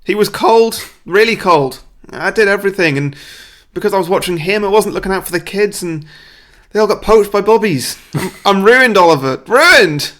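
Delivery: high, loud melodramatic wail; hammed-up crying. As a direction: break down in mock despair. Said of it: "Dodger breaks down crying".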